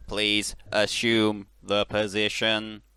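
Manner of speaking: slow, loud robot command; robotic scream. Retold or imitated. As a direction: bark it like a machine. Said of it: "he screams".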